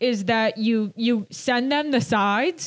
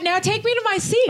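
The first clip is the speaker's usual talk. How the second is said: much higher mock customer voice; mock demanding fan; 10 semitones higher than usual. Fan ordering the doorman to seat them, demanding. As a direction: imitate a bossy patron, pitched up. Mocking fans bossing the doorman.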